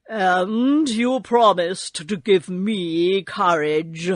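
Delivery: slow, loud lion voice; Cowardly Lion. A narrator's reading. Lion claiming his courage from Oz, slow creature voice. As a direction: draw it out as a big put-on beast. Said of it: "said the Cowardly Lion".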